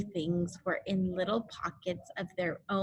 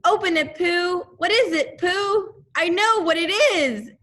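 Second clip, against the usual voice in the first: eager crowd; 10 semitones higher, 8 dB louder than usual. A narrator's reading. Everyone crowding Pooh eagerly.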